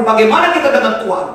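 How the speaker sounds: high, loud, exhorting sermon voice; full-force preaching. Real, not acted. Preacher questioning whether the congregation truly loves God, full-force preaching. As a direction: challenge the congregation with ringing questions.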